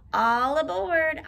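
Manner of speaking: loud, drawn-out conductor-style call. A narrator's reading. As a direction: call passengers aboard, stretching the words. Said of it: "Oscar called".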